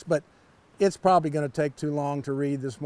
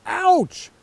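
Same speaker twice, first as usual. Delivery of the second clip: mock ouch; 11 semitones higher, 4 dB louder than usual. Frog gets splinters.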